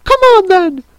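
very high, loud shriek; piercing royal command; roaring Queen. A narrator's reading. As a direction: yell the invitation as an order. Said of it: "roared the Queen".

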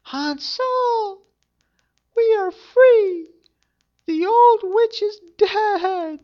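put-on high, loud, slow joyful girl; high shouted girl's joy; joyful cry. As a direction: cry out the good news to her brother. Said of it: "and cried".

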